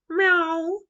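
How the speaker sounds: high, loud meow; a cat by Grandpa's ankles, high and loud. A narrator's reading. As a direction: mew like a cat.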